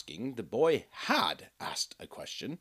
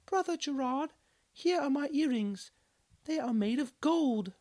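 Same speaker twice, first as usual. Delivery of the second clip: much higher woman's voice calling out; a townswoman pressing her gift on him; high excited villager woman; eager woman exclaiming. Acted. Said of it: "one woman exclaimed".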